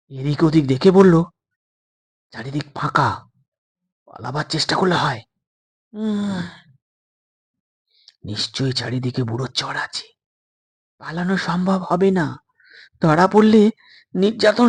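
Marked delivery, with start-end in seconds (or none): 2.3-5.2 softer, conspiratorial hush; boy quietly urges escape; hushed suggestion. Acted. 5.9-12.4 much softer, wary reply; boy warns quietly of the old man's spies; hushed warning. Acted.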